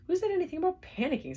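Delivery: high, loud, fast snap; snapping. A narrator's reading. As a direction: snap back defensively. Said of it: "snapped Arthur".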